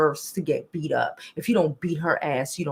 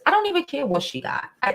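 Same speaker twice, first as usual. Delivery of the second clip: ordinary delivery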